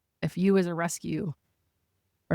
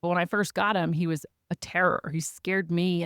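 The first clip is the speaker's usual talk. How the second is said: normal talking voice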